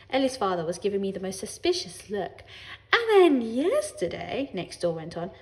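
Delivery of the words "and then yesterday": high, loud, drawn-out gossiping neighbour; storytelling neighbour. A narrator's reading. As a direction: relate the pet's death with great drama.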